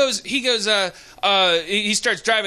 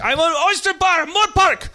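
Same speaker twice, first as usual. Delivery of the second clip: high mimicked yelling; mad driver; 9 semitones higher, 3 dB louder than usual. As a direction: imitate a driver trying to get through. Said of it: "mad at me".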